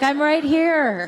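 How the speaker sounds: playfully offended, stretched out; laughing as she pretends to be put out; mock indignant. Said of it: "You're like".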